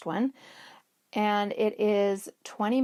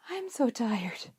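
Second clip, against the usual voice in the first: imitating a worn-out toddler, slightly higher, breathy; a whispery mock-exhausted quote; tired little niece; 4 semitones higher, slower than usual. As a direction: sigh it out like a tired child. Quoting tired niece lying down.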